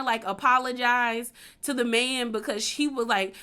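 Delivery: ordinary talk, nothing marked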